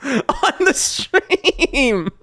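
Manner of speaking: high, slow laughing rant; cracking up in disbelief. Real, not acted. Cringing at his old stream.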